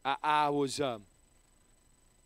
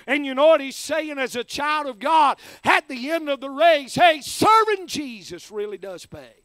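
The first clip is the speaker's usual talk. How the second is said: loud, raised fiery sermon voice; full-force preaching; 6 semitones higher, 9 dB louder, slower than usual. Acted. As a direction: preach it out with fervour to the crowd.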